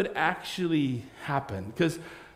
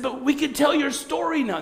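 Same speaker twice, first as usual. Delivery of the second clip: much higher, pleading "now!"; eager disciples; 11 semitones higher, 4 dB louder than usual. Acted. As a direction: beg to go tell everyone.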